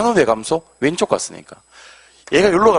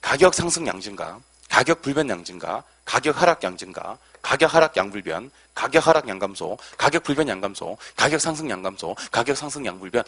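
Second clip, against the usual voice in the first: quick, even recitation in a lowered voice; rote read-through; 2 semitones lower, 4 dB quieter than usual. Shown as a demonstration. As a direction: rattle off each set softly in one rhythmic run. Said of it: "여덟 개 이동을 한번 읽어드릴게요". (리듬감 있게 술술 읽어 내려가기)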